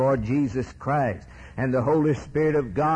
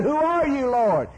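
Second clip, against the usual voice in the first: crying out; 13 semitones higher than usual. Acted. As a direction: cry it up to heaven. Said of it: "And he cried".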